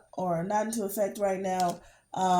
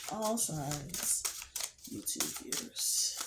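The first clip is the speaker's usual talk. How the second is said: whispered invocation; 13 dB quieter than usual. Acted. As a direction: whisper the call while shuffling. Shuffling while invoking Spirit.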